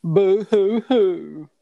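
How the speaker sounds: high, slow, hammed-up boo hoo; mock crying. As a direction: fake sob to mock a whiner. Mocking a friend's complaint.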